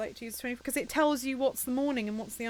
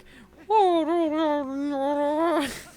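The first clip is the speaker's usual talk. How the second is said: long, loud creepy wail; imitated creepy voice; 7 semitones higher, 9 dB louder than usual. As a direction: imitate a creepy cartoon voice with one drawn-out noise. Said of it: "your one is like".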